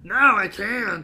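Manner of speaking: high, loud, drawn-out mock wail; mock exasperation. Co-host answers about Ben's money, mock wail. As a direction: stretch it out in hammed-up protest.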